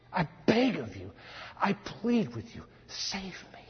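plea dropping to a whisper; hushed, spent begging; pleading prayer. Acted. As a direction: beg softly, fading to almost nothing. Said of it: "I'm sure the prayer was".

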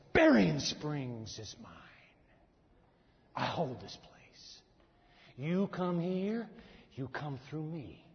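slow, low, menacing voice of an evil prince; demonic prince. Acted. Preacher voicing the demonic prince claiming the town, slow and menacing. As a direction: claim the town like a dark ruler, slowly. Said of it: "This is an evil prince".

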